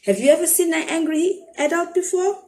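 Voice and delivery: ordinary delivery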